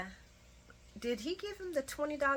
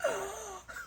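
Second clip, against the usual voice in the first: mock gasp; 12 semitones higher, 6 dB quieter than usual. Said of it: "everybody's like".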